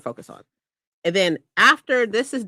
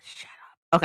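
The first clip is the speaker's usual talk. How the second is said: ordinary talk, nothing marked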